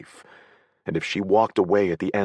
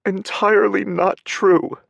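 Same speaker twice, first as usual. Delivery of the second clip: higher, louder tearful protest; sobbing; 8 dB louder than usual. A narrator's reading. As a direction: sob it out. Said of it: "she sobbed".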